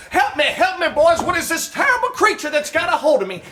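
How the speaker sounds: high, frantic cries for help; mock panicked scream. Texan bitten by a snapping turtle, hammed-up high panic. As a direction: yelp it like a turtle's got your foot. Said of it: "screaming to the top of his lungs".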